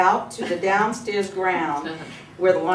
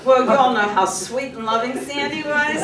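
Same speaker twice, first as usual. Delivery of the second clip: ordinary delivery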